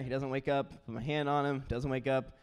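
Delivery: normal talking voice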